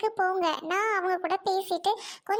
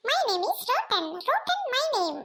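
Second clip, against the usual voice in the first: high, put-on character voice; show-off introduction; 6 semitones higher, slower than usual. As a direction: introduce yourself high and deliberately. Character introduces self in English. (உச்சக் குரலில் அறிமுகம்)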